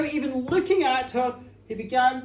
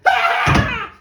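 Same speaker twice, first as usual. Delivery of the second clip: sudden shriek; 12 dB louder than usual. Acted. Sudden eruption of the wild dance.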